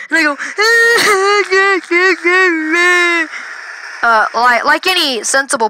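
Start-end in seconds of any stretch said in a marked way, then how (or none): 0.4-4.0 high, long wailing sob; mock bawling. Imitating self told it's in the shoulder, high wail. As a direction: imitate a kid bursting into tears. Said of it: "While I'm crying".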